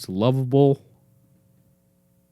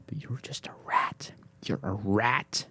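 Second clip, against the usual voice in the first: teasing whisper; mock-secret whisper to the dog; 5 dB quieter than usual. Real, not acted. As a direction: whisper it like a fond insult.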